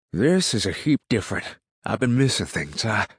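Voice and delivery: heated rustic objection; insistent retort. A narrator's reading. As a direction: argue back defensively. Neighbor argues back.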